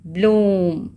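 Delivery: ब्लूम with the oo held; long oo. Shown as a demonstration. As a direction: linger on the vowel of ब्लूम. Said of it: "double ओ लंबा है".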